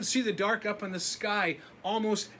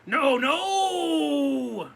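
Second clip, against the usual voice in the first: loud, higher booming dark voice; 7 semitones higher, 9 dB louder than usual. Acted. The dark calls the boy, booming loud. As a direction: call out as the looming dark. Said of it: "said the dark again".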